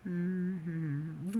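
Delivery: ordinary talk, nothing marked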